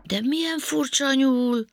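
high wondering start, settling down; amazed. A narrator's reading. Panka studying the odd rabbit, amazed. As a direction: marvel high, then ease to puzzlement. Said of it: "ámuldozott Panka".

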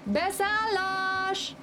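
very high, slow drawn-out call; conductor's call. A narrator's reading. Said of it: "a kalauz nagyot kiáltott". (kalauz elnyújtott, magas kiáltása)

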